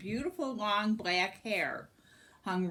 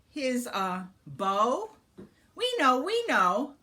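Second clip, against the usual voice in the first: nervous, higher and louder; flustered stammer; 5 semitones higher, 5 dB louder than usual. Acted. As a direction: fumble for an answer. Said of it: "they stammered in surprise".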